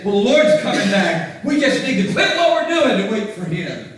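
mocking lazy believers. Mocking people quitting work.

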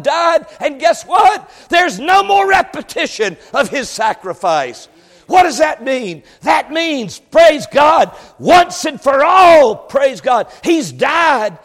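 high, exultant preacher's shout; full-force preaching. Real, not acted. Preacher praising God for the sacrifice at Calvary, full-force preaching. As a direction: burst into loud praise and keep driving.

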